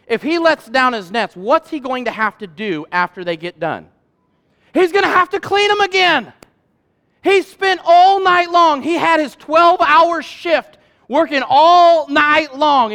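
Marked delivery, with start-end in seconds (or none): 4.7-12.9 higher and louder, slowed right down; rising emphasis. Real, not acted. Preacher pressing the congregation, loud and slow. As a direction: drive home how long the night was. Preacher stresses fishermen's exhaustion.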